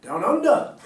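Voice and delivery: loud, high mock Australian accent; hammed-up Australian accent with a laugh; Aussie accent. Shown as a demonstration. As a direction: belt out a jokey Aussie accent. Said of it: "How do you do a Aussie accent?".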